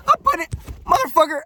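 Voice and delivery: fired-up rant, voice jumping high; heated outburst. Real, not acted. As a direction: bark it out like a challenge. Heated rant about outworking people.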